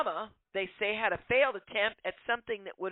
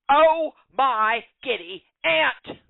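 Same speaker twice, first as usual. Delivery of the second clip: slow, louder, drawn-out dismay; shocked exclamation; 3 semitones higher, 8 dB louder, slower than usual. Real, not acted. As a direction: gasp it out in disbelief. Shocked by the text read out.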